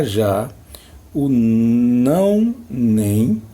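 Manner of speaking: ordinary talk, nothing marked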